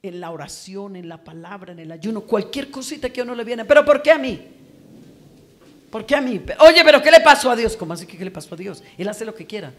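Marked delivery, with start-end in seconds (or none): 3.7-7.8 loud, high mock whine of why me; mock complaining. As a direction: imitate someone protesting to God, loudly. Imita a quien reclama a Dios. (queja imitada, alta y fuerte)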